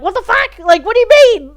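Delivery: high, loud what-the-hell; outraged viewers. Retold or imitated. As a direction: yell it like a confused fan. Imagined confused viewers.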